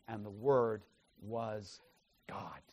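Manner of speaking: soft and low, pausing before "God"; hushed emphasis. Shown as a demonstration. Teacher showing what John put there for emphasis, hushed, weighty pauses. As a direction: set "was" and "God" apart, dropping very quiet on "God". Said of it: "For emphasis".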